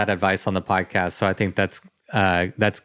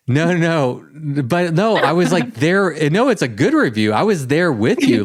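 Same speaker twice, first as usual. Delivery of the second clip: excited protest; 6 semitones higher than usual. Real, not acted.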